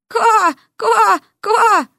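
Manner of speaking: high, loud mock croak; frog croak.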